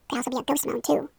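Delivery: ordinary delivery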